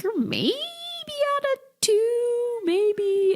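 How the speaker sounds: high, slow, drawn-out doubtful midwife; mock midwife voice. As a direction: stretch it out like a hesitant nurse. Mimicking midwife's cervix check.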